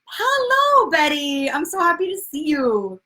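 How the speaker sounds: high sing-song hello to a viewer; light up as Betty signs on; excited greeting. Real, not acted. Host greeting Betty in the chat, excited. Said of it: "I got excited seeing everybody sign on".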